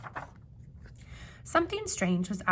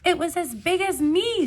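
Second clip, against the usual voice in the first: high, loud alarmed cry; alarmed exclamation; 10 semitones higher, 10 dB louder than usual. Acted. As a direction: blurt it out in alarm. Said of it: "said Clifford".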